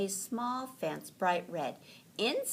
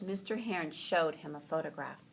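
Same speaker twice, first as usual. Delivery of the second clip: usual speaking voice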